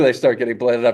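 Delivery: usual speaking voice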